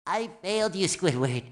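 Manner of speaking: low, soft admission of failure; dejected. Acted. Sponge who failed Squidward, low dejected voice. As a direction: let it sink at the end.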